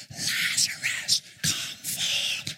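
weak whispered call; pitiful whisper. Pretend. Congregation's weak call mocked by preacher, whisper. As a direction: call him feebly, barely audible. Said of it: "Oh, that was pitiful".

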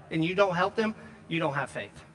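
usual speaking voice

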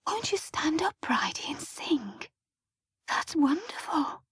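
soft church whisper, lifting in pitch. A narrator's reading. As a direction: whisper it so only she hears. Whispered exchange during the service.

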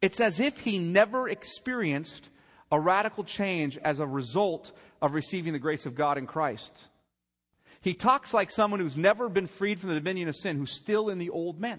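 loud, high preacher's build; preaching at full force. Acted. Preacher pressing the congregation, loud and high. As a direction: drive it home from the pulpit.

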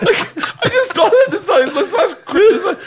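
talking high through laughter; laughing. Real, not acted. Telling friends a Christmas story, high laughing. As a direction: keep the giggle in the voice.

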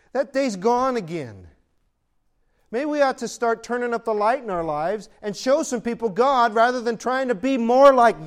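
loud, rising pulpit exhortation; preaching at full force. Acted. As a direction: build it up and call them out.

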